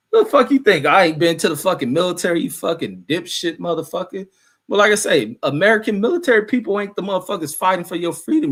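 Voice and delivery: high, loud indignant tirade; real angry outburst. Real, not acted. Streamer hitting back at a viewer about military service, angry. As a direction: tear into them, starting loud and high. Lashes out at commenter.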